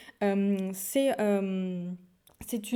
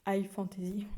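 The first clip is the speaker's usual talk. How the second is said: whispered retry; 5 dB quieter than usual. Shown as a demonstration. Booktuber redoing her bad English accent, whispered. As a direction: whisper the English term again to yourself. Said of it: "Mon accent anglais est vraiment catastrophique".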